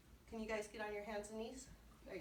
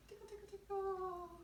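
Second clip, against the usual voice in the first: high, playful tickle chant; bouncy high tickle chant for a toddler class; sing-song tickle; 10 semitones higher than usual. Shown as a demonstration. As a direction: chant it high for little kids. Leading kids in a finger warm-up.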